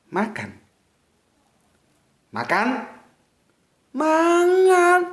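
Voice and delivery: makan said with shifting tune, rising into a high Mangan; vary the tune on makan so meaning changes; changing intonation. Shown as a demonstration. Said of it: "intonasi menentukan".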